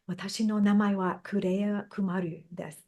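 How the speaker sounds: her name said the Japanese way, Kureya Kumaru; introducing herself in Japanese; Japanese pronunciation. Shown as a demonstration. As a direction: say it as a Japanese speaker would. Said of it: "Kureya Kumaru is how I would say it".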